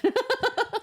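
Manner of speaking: a high burst of laughter; delighted shriek of laughter; high squealing laugh. Real, not acted. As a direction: cackle it off. Laughing off plot hole.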